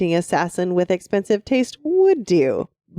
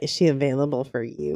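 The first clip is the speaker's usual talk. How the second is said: low, softer, mocking the sleazy noble; creepy noble voice; 6 semitones lower, 5 dB quieter, faster than usual. Co-host mocks the noble's creepy question in a low sneer. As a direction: sneer it like the gross noble asking. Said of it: "I don't even like the way he says that".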